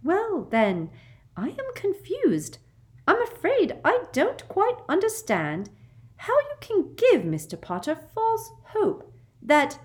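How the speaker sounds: put-on simpering high, girlish woman; sickly sweet and very high; sugary high voice. A narrator's reading. Umbridge's voice in the story.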